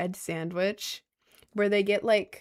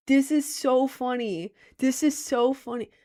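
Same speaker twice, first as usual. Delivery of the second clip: ordinary talk, nothing marked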